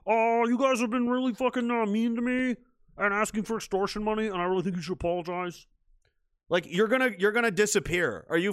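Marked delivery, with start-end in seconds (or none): 0.1-5.7 high whiny plea to the mafia; a hint of a pathetic complainer; mock meek complaint; whiny victim. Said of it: "be like".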